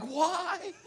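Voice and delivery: high, drawn-out questioning "why"; imitated exasperated wife. Wife looking at her husband after his blurt, imitated disbelief. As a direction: stretch it upward in mock disbelief. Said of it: "My wife's looking at me like".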